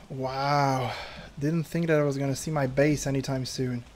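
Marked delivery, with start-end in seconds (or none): none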